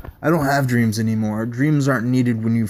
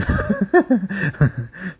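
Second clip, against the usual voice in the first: high, unhinged little laugh; creepy off-kilter chuckle; eerie giggle; 8 semitones higher than usual. Acted. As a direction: laugh as if the joke is only yours. Deranged subject laughs mid-ramble.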